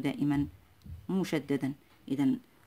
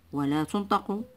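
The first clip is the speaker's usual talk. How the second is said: ordinary delivery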